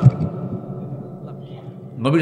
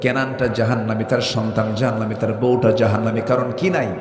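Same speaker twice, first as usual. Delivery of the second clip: full-force preaching; 6 semitones higher than usual. Acted. (জোরালো ওয়াজের সুর)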